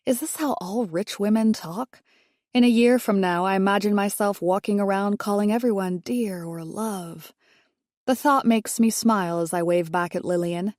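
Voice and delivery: plain reading voice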